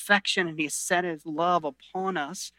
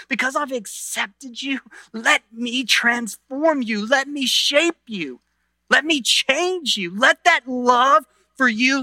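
passionate plea; 6 semitones higher than usual. Retold or imitated. Preacher voicing Jesus pleading with sinners, rising and slow. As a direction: build an urgent invitation. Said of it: "what he's saying to you is".

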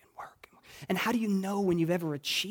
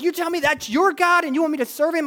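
high, fast indignant challenge; retold heated retort, rushed; imitated anger; 9 semitones higher, 4 dB louder, faster than usual. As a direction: act out an angry man's incredulous question. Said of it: "just so angry at church".

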